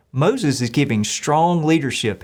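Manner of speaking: usual speaking voice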